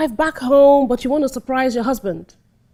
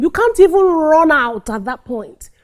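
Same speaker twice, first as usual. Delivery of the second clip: usual speaking voice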